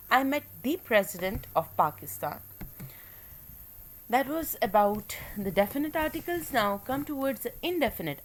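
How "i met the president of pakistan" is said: "the" said as a stressed "thee" before president; emphatic the. Shown as a demonstration. Teacher demonstrating the emphatic "the" as "thee". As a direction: lean on "the" as "thee" to single out the president. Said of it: "emphatic the".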